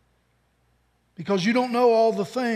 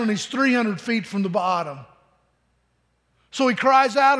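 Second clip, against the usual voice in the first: normal talking voice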